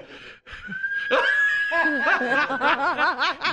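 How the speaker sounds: laughter rising to a shriek. Real, not acted. Audience howling at the clown's punchline.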